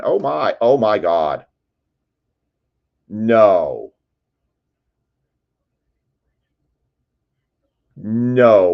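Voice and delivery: loud stunned disbelief; shocked excitement. Real, not acted. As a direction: react like you just hit big. Big card hit on stream.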